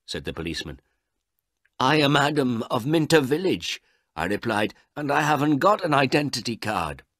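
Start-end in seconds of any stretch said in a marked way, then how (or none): 1.8-3.8 high, loud, proud reply; stoutly insistent; defiant declaration. Acted. Old man answers policeman defiantly. 5.0-7.0 high, louder, indignant reply; raised-voice insistence; defiant admission. Acted. Old man answers policeman defiantly.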